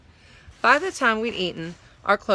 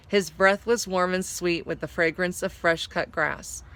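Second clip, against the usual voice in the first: plain reading voice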